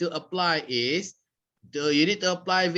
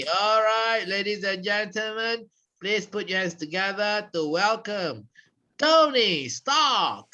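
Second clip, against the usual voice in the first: show announcer; 3 semitones higher than usual. Pretend. Trainer welcoming a trainee to the front, announcer style. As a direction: build up to a stretched-out name.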